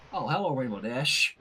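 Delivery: usual speaking voice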